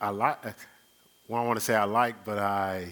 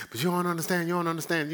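much higher, pleading "you don't understand"; frantic person; 8 semitones higher, 3 dB louder, much faster than usual. Retold or imitated. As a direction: act out someone panicking in a hopeless situation. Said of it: "they're frantic and they say".

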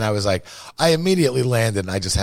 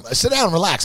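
friendly host; 11 semitones higher, 3 dB louder than usual. Retold or imitated. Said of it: "people, like".

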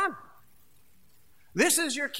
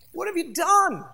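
higher, louder stern question; God confronting Cain; 7 semitones higher, 5 dB louder than usual. Acted. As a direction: demand an answer, shocked and stern. God confronts Cain after murder.

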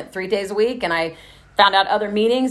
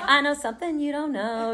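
smug singsong tease; 6 semitones higher, 5 dB quieter than usual. Retold or imitated. Smug secret teasing.

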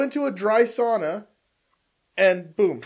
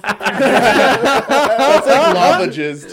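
laughing; 6 semitones higher, 9 dB louder, much slower than usual. Real, not acted. Laughing at the joke.

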